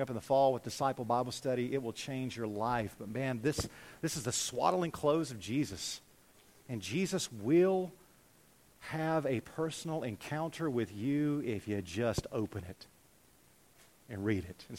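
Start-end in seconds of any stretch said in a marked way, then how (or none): none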